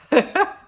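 a loud, high laugh bursts out; burst of high laughter. Real, not acted. Telling viewers about her question, a loud high laugh.

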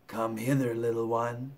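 coaxing crocodile. Acted. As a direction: lure the little one in quietly.

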